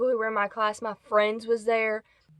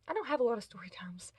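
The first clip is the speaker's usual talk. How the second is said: whispered aside; 8 dB quieter than usual. Real, not acted. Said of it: "closing aside".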